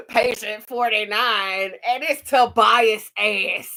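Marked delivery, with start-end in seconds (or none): none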